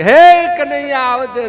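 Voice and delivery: loud, high call for help; crying out. Retold or imitated. Draupadi cries to Kanaiya after the cloth slips, loud outcry. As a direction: throw the name out high, then ease off. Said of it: "રાડ નાખી કે".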